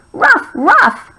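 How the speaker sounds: high, light dog barks; dog barking. A narrator's reading. Teacher reading the story together, dog barking. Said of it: "barked Kaya".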